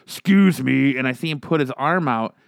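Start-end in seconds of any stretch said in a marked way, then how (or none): 0.0-1.0 [name]'s gravelly smoker imitation; grumbly smoker voice. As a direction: growl it like a chain smoker. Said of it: "[name]'s fucking grumbly smoker voice".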